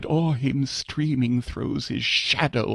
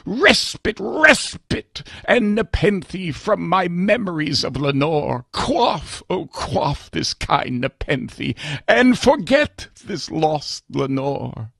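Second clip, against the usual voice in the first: anguished plea; 7 semitones higher than usual. Acted. Narrator pleads for relief from grief over Lenore, higher and urgent. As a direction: beg for forgetting, voice straining. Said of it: "I cried".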